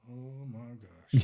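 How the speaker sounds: slow, drawn-out oh my gosh; stunned realization. Retold or imitated. Guide realizing his fishing client is the CFO, slow and stunned. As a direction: let it sink in slowly, stunned.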